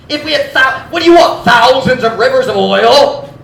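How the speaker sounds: high, loud exasperated mock plea; mocking complaint. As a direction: whine at God in sarcastic despair. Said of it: "They're saying".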